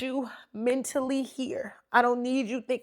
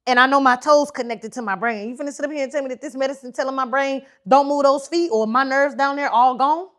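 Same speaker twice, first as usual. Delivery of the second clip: retold panicked outburst; 4 semitones higher, 9 dB louder than usual. Panicking at the student nurse.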